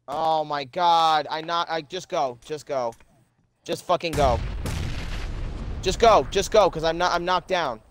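desperate pleading. Real, not acted. Downed, pleading partner to flee.

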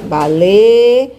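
"baleia" loudly stretched in pieces for a pupil; sounding out E. Shown as a demonstration. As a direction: raise and hold the E in "baleia", then finish the word. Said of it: "Tem o som do E".

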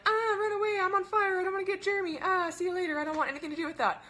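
high-pitched, loud comic freak-out; mock panic. Coach mimicking people who hear network marketing, mock panic. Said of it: "they hear network marketing and they're like".

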